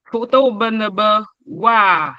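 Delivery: "Faute au ben ba wa" said slowly, leaning on "au" with a fall; slow tone demo. Shown as a demonstration. Teacher slowly modelling "Faute au ben ba wa" for the class. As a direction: stretch out "Faute au ben ba wa" syllable by syllable. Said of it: "Le ton montant".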